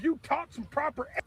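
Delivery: re-yell the rant at the manager; retold shouting. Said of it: "I just screamed at everybody in the store".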